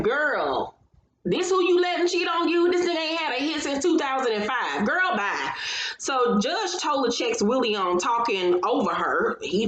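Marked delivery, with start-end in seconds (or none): 0.0-5.5 high mocking girl please; sassy disbelief. To the couple on screen, a high mocking brush-off. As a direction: roll your eyes and dismiss him. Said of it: "And I was like".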